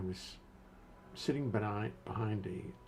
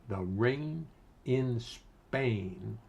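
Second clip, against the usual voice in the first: drawn out; much slower than usual. Shown as a demonstration. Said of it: "test phrase drawn out".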